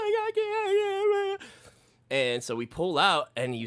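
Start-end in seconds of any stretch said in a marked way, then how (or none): 0.0-1.5 very high, frantic repeats; mock squeal of refusal; panicked kid. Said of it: "He's like".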